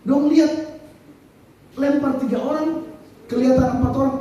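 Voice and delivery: normal talking voice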